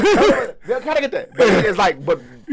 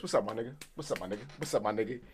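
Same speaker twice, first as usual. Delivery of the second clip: quick, low, tossed-off greeting; casual imitated greeting; 10 semitones lower, 17 dB quieter, faster than usual. Retelling Chris greeting people in the first film, casual. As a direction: say it softly and easily, in passing.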